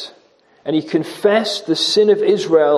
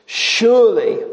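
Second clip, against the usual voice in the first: "Surely" alone, raised and falling decisively; conviction and assurance; 5 semitones higher than usual. Shown as a demonstration. Preacher showing the word of assurance, emphatic "Surely". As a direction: land "Surely" high as a settled certainty. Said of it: "words of personal conviction and assurance".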